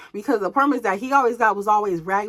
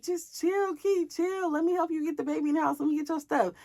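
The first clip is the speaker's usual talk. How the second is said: softer, higher imitation of a placating partner; 7 semitones higher, 6 dB quieter than usual. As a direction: lightly mimic him trying to calm you down. Partner placating her after the yelling.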